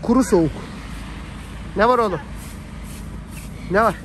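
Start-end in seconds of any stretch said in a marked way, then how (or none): none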